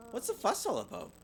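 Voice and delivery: high, sneering question; snooty older girl. Acted. Queenie McBear interrupting the girls, snooty and high. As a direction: look down your nose at them. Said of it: "Queenie McBear".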